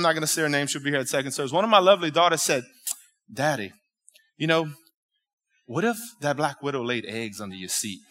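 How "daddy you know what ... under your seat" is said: soft, low voice for his daughter; daughter's sly question. Retold or imitated. Daughter teasing dad about spider eggs, softly imitated. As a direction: ease it out softly, with pauses.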